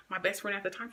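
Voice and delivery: ordinary talk, nothing marked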